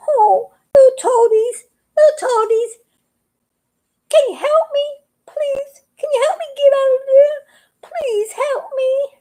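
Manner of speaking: high, tearful begging; pleading cry. Acted. Stuck frog crying to the toadies for help. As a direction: beg through tears, voice pitched high.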